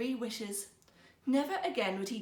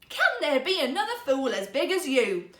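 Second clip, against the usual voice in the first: much louder, furious; storming; 5 semitones higher, 9 dB louder than usual. A narrator's reading. Wife storming at her husband, enraged. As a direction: storm at him. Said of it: "she stormed".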